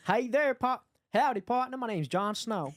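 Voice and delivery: high, hammed-up Texan howdy; Texas accent. Shown as a demonstration.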